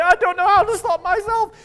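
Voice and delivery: high, loud, strained cry of someone out of control; helpless wail. Retold or imitated. You, embarrassed after an angry rampage, wailing helplessly. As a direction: wail it as if you really can't stop. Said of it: "You're like".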